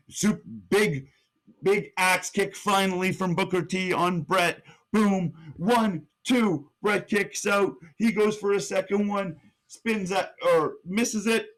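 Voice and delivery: excited high-pitched match call; live play-by-play. Acted. Calling Booker T against Bret, excited play-by-play. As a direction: call the moves blow by blow, stretching the final word.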